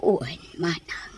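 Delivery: breathy, frail reply; weak whisper. Acted. Sick sister answering her older sister in a whisper. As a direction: barely voice the yes.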